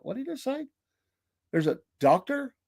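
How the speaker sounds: hushed, high-pitched mock bafflement; puzzled aside. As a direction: ask it softly, as if puzzled to yourself. Baffled aside in retold story.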